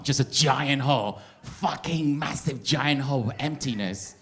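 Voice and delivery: ordinary talk, nothing marked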